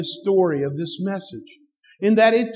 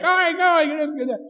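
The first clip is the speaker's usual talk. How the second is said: mock hurry-up; 10 semitones higher, faster than usual. Dad sending boys off.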